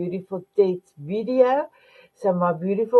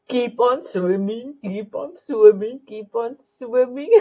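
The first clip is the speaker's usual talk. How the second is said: playful Dory voice; 3 semitones higher than usual. Retold or imitated. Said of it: "What does Dory do? She says".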